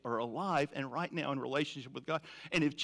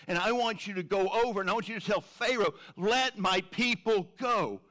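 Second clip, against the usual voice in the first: commanding; 5 semitones higher, 5 dB louder than usual. Acted. God commanding Moses.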